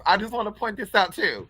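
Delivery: ordinary talk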